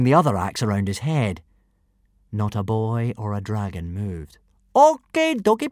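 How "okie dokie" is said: high, pushed foreign soldier voice; kidnapper voice. A narrator's reading. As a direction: play the bumbling brute. First kidnapper voice.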